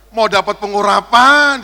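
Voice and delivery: loud, slow mock groan to God; pained outcry. Pastor being hit on the head, crying out. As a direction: cry out as the blows land.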